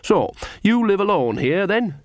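high, chirpy small talk; bright. A narrator's reading. Nanny turns to her hostess to make conversation, brightly. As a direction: ask brightly, pitch lifted. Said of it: "she said brightly".

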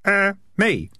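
put-on high, hesitant kid voice; high-pitched, tentative youngster; hesitant boy. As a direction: own up uncertainly in a boy's high voice. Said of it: "said the boy".

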